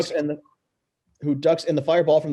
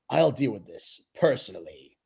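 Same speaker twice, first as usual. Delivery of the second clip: menacing villain; 5 semitones lower than usual. Acted. Koopa villain line.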